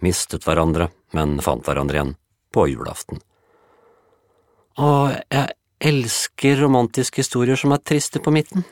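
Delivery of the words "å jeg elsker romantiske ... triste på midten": put-on high dreamy girl's voice with a sigh. Dreamy neighbour girl to Juliette, sighing. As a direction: swoon over the sad middle of the story. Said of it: "sukket Lise".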